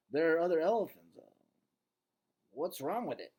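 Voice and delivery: Bob the dog. Acted. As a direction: Bob pressing his friend.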